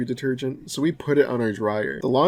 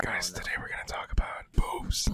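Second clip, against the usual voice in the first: breathy mock ASMR whisper; 4 semitones lower, 9 dB quieter than usual. Friends joking about ASMR, mock whisper.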